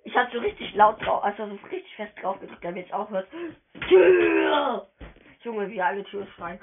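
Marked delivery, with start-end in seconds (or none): 3.9-4.9 loud high yell of Tür; shouted Tür. Real, not acted. Gamer yells Tür along with the chat, loud shout. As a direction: bellow it out to everyone.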